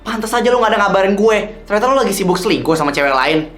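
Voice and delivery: loud, high, accusing tirade; angry accusation. Acted. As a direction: tear into him for cheating. Confronting cheating boyfriend.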